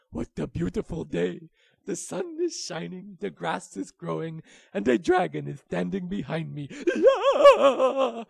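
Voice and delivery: loud, laughing glee; cheerful wolf. A narrator's reading. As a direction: bounce with joy. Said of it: "and said".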